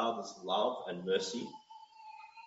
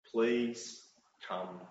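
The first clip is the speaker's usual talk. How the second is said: tender plea; 5 semitones lower, 6 dB quieter, much slower than usual. Acted. Pastor pleading with listeners.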